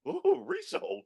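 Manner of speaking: high, soft mock surprise; mock shocked ooh. Reacting to the friend's snap, high mock hurt ooh. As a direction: feign being stung, high and light. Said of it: "I was like".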